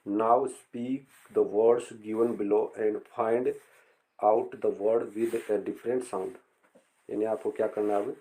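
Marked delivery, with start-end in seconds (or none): 0.1-6.3 very slow, word by word; slow textbook reading. Shown as a demonstration. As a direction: spell out the instruction slowly for students. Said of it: "reading textbook instruction slowly". (निर्देश बहुत धीरे पढ़ना)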